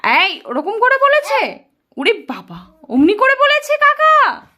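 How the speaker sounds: high, loud, slow mock astonishment; mock shock. Mother playing shocked for toddler about uncle, high slow mock astonishment. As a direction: gasp it out in big pretend shock.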